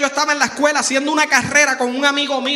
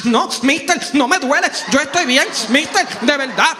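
loud, higher desperate pleading; insistent boy; 4 semitones higher, 6 dB louder than usual. Retold or imitated. Hurt boy begging principal, loud pleading. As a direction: keep begging the principal not to call.

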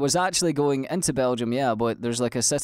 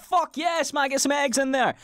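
mock cocky cheer; 13 semitones higher than usual.

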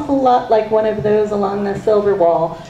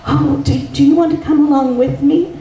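eager invitation; 6 semitones higher, faster than usual. Acted. Espen Ash eager to share.

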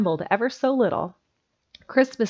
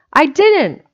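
put-on high, loud childish protest; heated denial; 8 semitones higher, 8 dB louder than usual. Specs denies it once more to Jimsy, heatedly. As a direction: deny it harder, pitch and volume jumping. Boys squabble, denial escalates.

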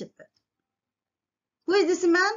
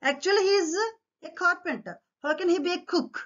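high-pitched questioning of the claim; interrogating; 9 semitones higher, faster than usual. Acted. King doubts the carpenter's new job, interrogating. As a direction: press the objection in a raised pitch. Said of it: "What he interrogate అమ్మా?".

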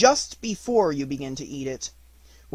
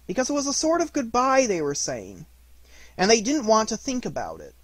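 higher, louder storyteller voice; story narration; 4 semitones higher, 4 dB louder than usual. A narrator's reading. Narrator reading a farewell scene to children, higher voiced. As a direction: tell it gently as a sad goodbye.

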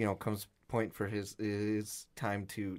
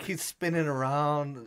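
ordinary delivery

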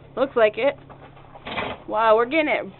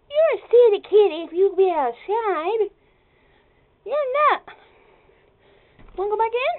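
high sing-song voice for a cat; pet talk; 7 semitones higher than usual. Real, not acted. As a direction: coo at the kitty, teasing. Talking to the cat.

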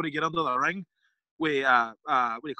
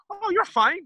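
makeup lady; 13 semitones higher, 4 dB louder, slower than usual. Retold or imitated. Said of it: "She looks at my hair and goes".

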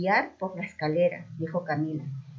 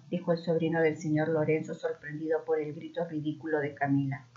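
plain reading voice

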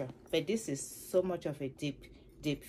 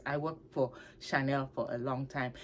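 usual speaking voice

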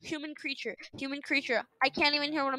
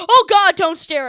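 loud, higher yelp of alarm; startled dread; 5 semitones higher, 17 dB louder than usual. Real, not acted. Player startled by a staring face, alarmed cry. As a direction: cry out as if suddenly stared down.

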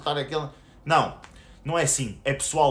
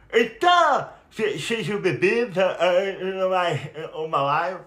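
stammering mock impression; 3 semitones higher, 3 dB louder, slower than usual. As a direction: stammer like a scared rival caught out. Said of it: "É assim que ele vai falar".

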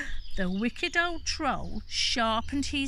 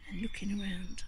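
whisper; 7 dB quieter, faster than usual. Real, not acted. Soft closing.

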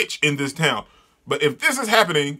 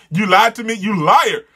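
acted angry accusation; 5 semitones higher, 5 dB louder than usual. Jilted manga character accuses her.

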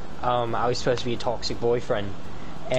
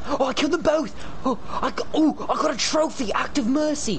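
excited; 11 semitones higher than usual. Real, not acted. Thrilled after killing zombies.

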